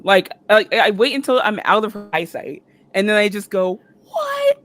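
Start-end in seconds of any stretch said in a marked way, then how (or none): 4.1-4.6 high, loud mock shriek; retold scream. Fan round the corner after meeting the star, screaming. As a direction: shriek it in disbelief, acting it out. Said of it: "screaming at the top of my lungs".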